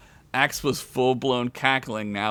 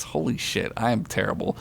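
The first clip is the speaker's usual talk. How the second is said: whispering under breath; whispered aside; 4 semitones lower, 5 dB quieter than usual. Real, not acted. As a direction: breathe it out, unvoiced. Self-remark while reading.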